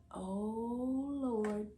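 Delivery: ordinary delivery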